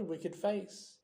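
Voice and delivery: ordinary delivery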